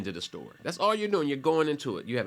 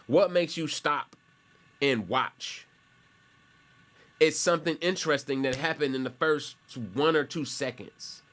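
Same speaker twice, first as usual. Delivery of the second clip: ordinary delivery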